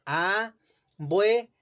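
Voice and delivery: "Abuelitos" split slowly as a-bue-li-tos; "Abuelitos" broken slowly into its syllables, a-bue-li-tos; slow syllabified. Shown as a demonstration. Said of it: "¿Cómo queda la palabra abuelitos?".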